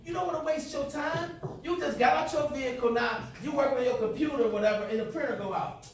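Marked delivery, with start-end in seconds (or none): none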